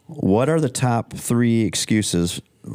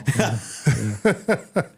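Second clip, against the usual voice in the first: laughing; 3 semitones higher than usual. Real, not acted. Laughs at jail excuse.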